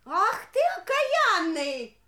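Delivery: медленно и громко, с возмущением; властно негодует; возмущённый крик. Acted. Царица возмущена грубым мужем. (возмущённая царица)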